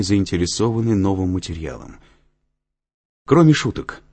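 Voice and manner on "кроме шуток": higher, louder interjection; raised voice turning serious after laughter; calling to attention. A narrator's reading. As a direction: pull the class back to business. Lecturer calls the class to order.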